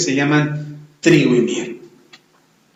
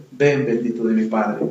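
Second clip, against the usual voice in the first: gentle welcome; 6 semitones lower, 5 dB quieter than usual. Acted. The Lord welcoming the faithful.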